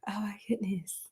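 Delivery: an awed whisper, barely voiced; hushed whisper. Real, not acted. Streamer whispering to herself at a chat message, hushed. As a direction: breathe it out in a whisper.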